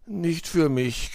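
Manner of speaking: slow, yawned refusal; yawning. A narrator's reading. Sleepy girlfriend declines a late-night scary story, yawning. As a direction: stretch the words through a yawn. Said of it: "gähnte Luzi".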